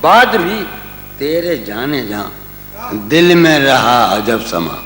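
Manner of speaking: lingering poetic recital, dropping at line ends; tender romantic poetry. Acted. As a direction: recite the couplet with feeling. Recites ghazal couplets.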